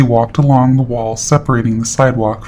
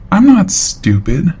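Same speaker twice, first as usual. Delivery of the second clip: firm rebuke; 8 semitones higher, slower than usual. Retold or imitated. Confronting the creep.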